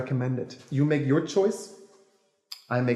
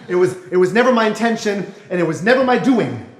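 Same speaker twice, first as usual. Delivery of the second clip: second insistent take; 5 semitones higher, 8 dB louder than usual. Acted. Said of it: "that also doesn't sound so good".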